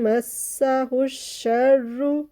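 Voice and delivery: मस्साहु शर्रु with the doubled स and र pressed hard together; slow tajweed recitation; strong shadda. Shown as a demonstration. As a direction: chant slowly, gripping each double letter. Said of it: "सबको हमने मजबूती से strongly मिलाते हुए".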